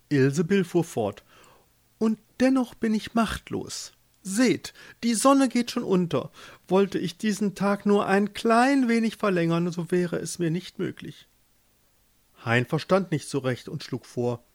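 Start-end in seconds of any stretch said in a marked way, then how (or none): 2.0-11.3 high, declaimed complaint; grand lady pope, discontented. A narrator's reading. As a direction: bemoan your powerlessness in a lofty high voice. Voices the pope Ilsebill. (unzufriedene Päpstin, hoch klagend)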